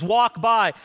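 ordinary delivery

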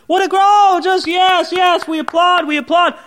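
applauding audience. Acted. Audience cheering a speech maker's funny anecdotes, mock adulation. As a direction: gush like an impressed crowd. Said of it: "the whole audience would be laughing".

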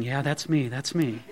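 low, hushed murmur of agreement; quiet admission. Retold or imitated. Pastor voicing listeners who relate, quiet admission.

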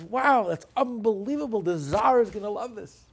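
high, loud burst of retold delight; delighted amazement. Minister thrilled with an answer for the Tsar, delighted amazement. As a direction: act out being thrilled by the answer. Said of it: "He loved it".

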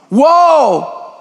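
a high, loud cry of awe; startled whoa. Acted. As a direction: throw out a sudden shouted whoa. Preacher's sudden exclamation.

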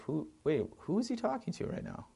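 high, softened voice of confusion; puzzled viewer. Acted. Film viewer asking himself about the scene, puzzled. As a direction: act out a confused double-take.

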